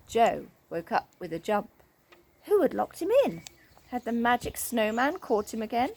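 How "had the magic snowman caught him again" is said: ordinary delivery, nothing marked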